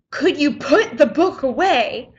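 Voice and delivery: mild mock yell, slow and emphatic; retold yell. Teacher calling across the science lab, mock yelling. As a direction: imitate a teacher yelling, drawn out, without full volume. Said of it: "He literally yells".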